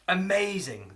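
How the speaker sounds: normal talking voice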